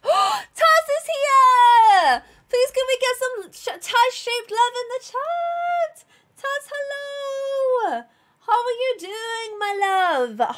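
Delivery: gasp, then high, slow, delighted hello; thrilled streamer welcoming a friend to chat; excited greeting. Real, not acted. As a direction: squeal hello to a friend who just arrived.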